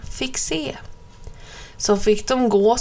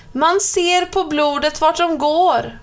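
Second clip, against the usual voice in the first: put-on excited children; 6 semitones higher than usual. Schoolchildren calling out.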